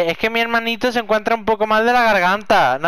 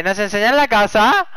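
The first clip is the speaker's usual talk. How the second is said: ordinary talk, nothing marked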